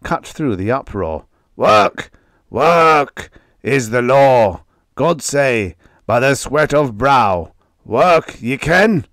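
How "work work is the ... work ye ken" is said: loud, slow, harsh preacher of work; cadaverous foreman snarling at the workers; harsh fanatic voice. A narrator's reading. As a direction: rasp out the law like a zealot. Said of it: "harsh and sharp as a steel file".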